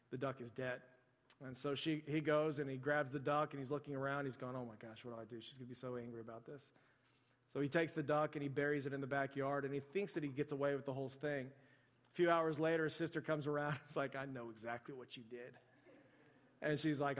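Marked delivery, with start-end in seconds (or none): 4.6-6.7 low, soft fretting aside; hushed worry. Retold or imitated. Boy wondering what to do about the dead duck, hushed worry. 14.2-15.5 low, soft insinuation; hushed knowing accusation. Retold or imitated. Sister to brother who buried the duck, hushed knowing accusation.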